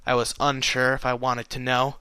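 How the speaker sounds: usual speaking voice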